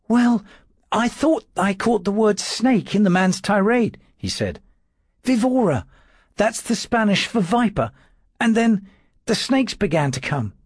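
high, thin timid clerk's voice; a frightened servant trying to sound firm; nervous little secretary. A narrator's reading. Dawes answering his lordship's questions, high and nervous.

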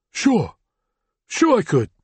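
high-pitched, rushed thug eagerly agreeing; a scared thug agreeing fast to save himself. Acted. Wounded thug to Brennan, high and eager.

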